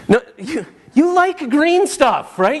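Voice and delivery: high, slow, loud parent voice; a very high, slow plea to a stubborn kid; coaxing parent. Retold or imitated. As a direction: drag it out like a patient parent pleading. Parent coaxing picky child.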